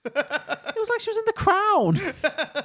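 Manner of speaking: high-pitched, drawn-out mock-royal voice; plummy British impression. Co-host comparing the accent to The Crown, high plummy mock voice. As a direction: keep up the high, plummy phone-voice imitation.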